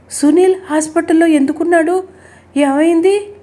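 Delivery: high, pressing worried questions; anxious. A narrator's reading. Anxious mother questions son's friends. (ఆందోళనగా, గొంతు పెంచి)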